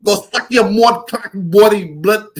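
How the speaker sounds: hammed-up Jamaican curse; mock Jamaican accent. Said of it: "They be going in them accents".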